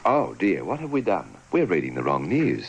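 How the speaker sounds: normal talking voice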